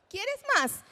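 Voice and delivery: put-on high, lively offer to a child; bright, high-pitched question to a small child; adult offering. Shown as a demonstration. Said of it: "tenemos también la ecolalia".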